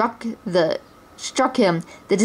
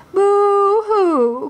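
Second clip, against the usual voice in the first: high, loud, babyish fake sobbing; the puppet prince mocked as a crybaby, boo-hooing; mock boo-hoo crying; 12 semitones higher, 4 dB louder than usual. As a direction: blubber like a spoiled child. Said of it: "cried the dummy Prince John".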